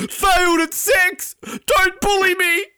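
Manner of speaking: very high, loud, slow shouting; hammed-up falsetto shriek of self-pity; mock outraged wail. Skier posting on Instagram after failing, mock outraged wail.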